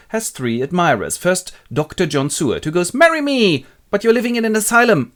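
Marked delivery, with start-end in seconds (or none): 2.9-3.6 high, loud eager proposal. Acted. Acted proposal by Doctor Seward. 3.9-5.1 high, loud, quick objection; protesting young lady. Acted. Lucy rejects first suitor.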